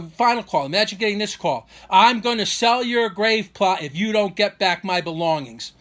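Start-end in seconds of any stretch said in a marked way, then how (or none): 1.9-5.7 slow, loud, deliberate threat; imitated threat. Father phoning son with final demand, imitated threat. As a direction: mimic an ultimatum, every word drawn out and pushed.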